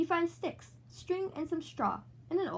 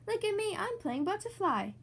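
mild shout; 7 semitones higher than usual. Acted. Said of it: "Peppa shouts".

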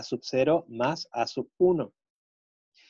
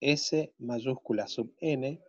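dictating; 4 dB quieter, slower than usual. Shown as a demonstration. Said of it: "teacher dictating to class".

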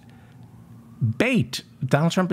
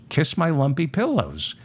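ordinary talk, nothing marked

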